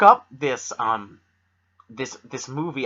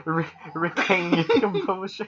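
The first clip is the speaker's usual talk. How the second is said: giggly mocking echo; teasing through laughter; 4 semitones higher than usual. Real, not acted. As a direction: repeat it back, laughing.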